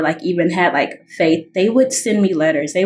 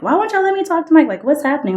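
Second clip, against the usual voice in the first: the upset version, pushed up and rushed; upset demand; 9 semitones higher, faster than usual. Retold or imitated. To her parents, a high, fast upset demand. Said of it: "I was upset".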